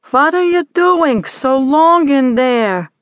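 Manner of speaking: high, loud, slow mother's holler. A narrator's reading. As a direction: call through the door from the kitchen. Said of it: "Sylvia hollers from the kitchen".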